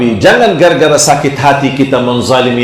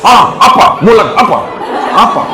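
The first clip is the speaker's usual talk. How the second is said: angry retort; 5 semitones higher than usual. Acted. A woman in the market snaps back at an insult. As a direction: snap back, picking a fight.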